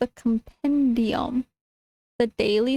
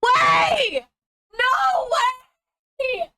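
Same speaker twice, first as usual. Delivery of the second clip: real screaming; 4 semitones higher than usual. Real, not acted. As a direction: scream in shock at the pull. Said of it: "rip my vocal cords".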